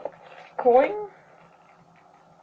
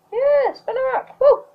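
excited; 13 semitones higher than usual. Real, not acted. Cheering at a pull.

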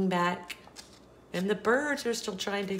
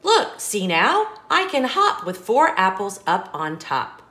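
put-on bouncy storybook reading for a child; lively read-aloud; 8 dB louder than usual. Parent reading an apple-stacking book to a child, sing-song. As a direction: read it like a bedtime picture book, rhythmic and bright.

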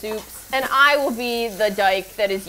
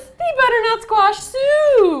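announcer voice; 13 semitones higher, 8 dB louder, slower than usual. Pretend. Announcing soup like a host.

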